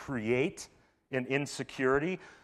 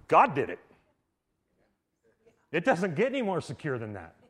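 ordinary delivery

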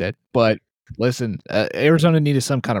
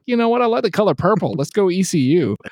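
high-pitched silly drunk imitation; mock drunk bettor; 8 semitones higher than usual. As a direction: play a tipsy fan making a daft pick. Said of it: "get drunk and be like".

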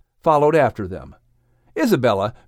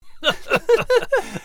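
high laugh. Real, not acted. Laugh after chapter ends.

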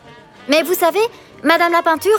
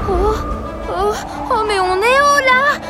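frightened; 4 semitones higher than usual. Acted. Lifted high by the rope.

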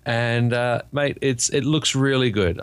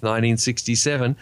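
ordinary delivery

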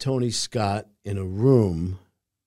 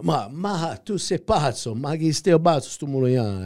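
higher, louder Italian wiseguy voice; Italian impression; 6 semitones higher, 5 dB louder than usual. Actor showing the host his Italian bit, animated. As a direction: gesture through it like a hothead. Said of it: "you can't do this without gesturing".

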